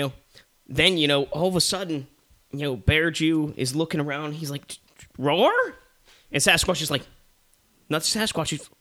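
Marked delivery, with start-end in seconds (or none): 5.2-5.5 higher roar with rising tune; questioning roar. Acted. Bear looks around, puzzled.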